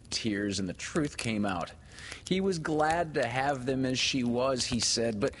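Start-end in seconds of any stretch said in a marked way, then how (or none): none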